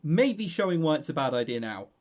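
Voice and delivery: ordinary delivery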